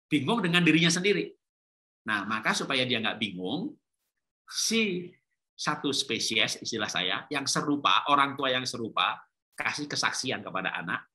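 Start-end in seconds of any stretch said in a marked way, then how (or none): none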